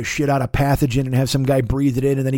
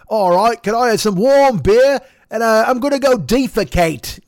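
higher, louder mock British accent, slow on the beer order; mock British pub bloke; 7 semitones higher, 5 dB louder than usual. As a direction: ham up a gap-toothed English football fan ordering warm beer. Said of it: "four-tooth motherfucker in a pub".

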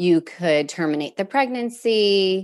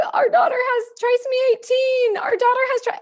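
much higher, breaking, near-sobbing voice; hysterical. Retold or imitated. Mom telling husband the diagnosis on the phone, hysterical. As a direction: blurt it out as if falling apart. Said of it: "and I am hysterical".

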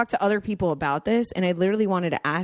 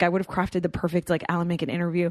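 usual speaking voice

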